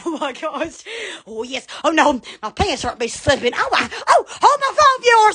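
ordinary talk

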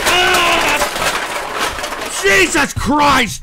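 high, strained raging outburst; outraged. Real, not acted. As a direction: rage with drawn-out cries. Rages at donor.

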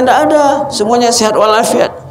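high, changed voice; weeping friend's reply. Acted. The weeping companion answering his visiting friend, high and changed.

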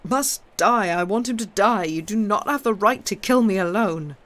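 very loud, high, frantic shouting; desperate crying out. A narrator's reading. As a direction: scream in furious protest. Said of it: "the prisoner still crying out".

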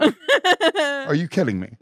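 high, slow, laughing disbelief. Real, not acted. Mocking his sister for calling a pony a baby horse, laughing. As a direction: stretch it out through laughter.

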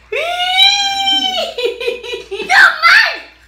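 high loud laugh-cry from the spice; shriek-laugh at the burning heat. Real, not acted.

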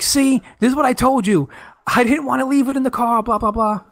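high-pitched retold I-told-you-so; mock scolding. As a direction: lightly scold a friend, pitched well up. Retelling his scolding I-told-you-so to friend.

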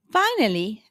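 plain reading voice

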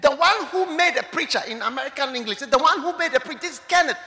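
announcing relative. Retold or imitated. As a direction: announce him eagerly to the dying woman. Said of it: "he said that".

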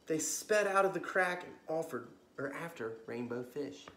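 lively bedtime storybook reading; story narration. A narrator's reading. Storyteller reading to kids at bedtime, lively. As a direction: read it like a children's librarian at story time.

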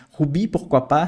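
ordinary talk

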